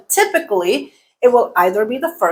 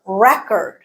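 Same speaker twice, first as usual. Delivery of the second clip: "record" with the stress up front, REC-ord; noun stress on first syllable. Shown as a demonstration. Teacher giving the noun example, first-syllable stress. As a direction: punch the first syllable of "record", reduce the second. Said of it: "Stress is on the first syllable".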